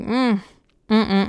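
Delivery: admiring hum; a drawn-out admiring mm over a handsome actor; humming approval at a crush; appreciative mm. Retold or imitated. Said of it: "'cause I was like".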